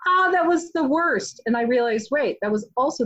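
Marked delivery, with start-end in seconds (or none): 0.1-1.4 put-on higher, squealing kid's voice; recalling a neighbor's jump scare, a playful squeal; childish mock fright. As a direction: shriek like a little girl spooked at Halloween. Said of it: "I would be like".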